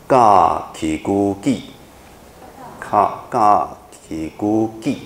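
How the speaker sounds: drawn-out spelling chant for the class; very slow recitation. Shown as a demonstration. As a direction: lead the class through the spelling slowly. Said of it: "我们念一下".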